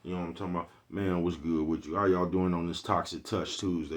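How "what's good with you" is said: ordinary talk, nothing marked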